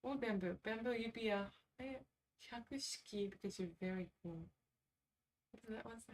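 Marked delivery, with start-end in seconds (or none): none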